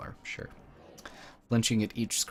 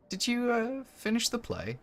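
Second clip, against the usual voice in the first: higher, hesitant cultist woman voice; awkward cultist. Acted. Cultist woman asking if the vessel finished the play, higher and awkward. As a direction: ask it a bit embarrassed.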